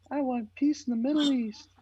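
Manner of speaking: very high, put-on pageant girl voice; pageant contestant. Little Mary Jo answering the Miss America pageant question, high mock contestant voice. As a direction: squeak it out like a parody beauty queen. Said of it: "little Mary Jo".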